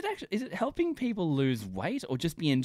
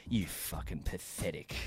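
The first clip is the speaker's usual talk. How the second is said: lower, softer contempt; low sneering insult; 8 semitones lower, 10 dB quieter than usual. Retold or imitated. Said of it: "it was like".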